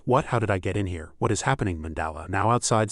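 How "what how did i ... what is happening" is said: ordinary delivery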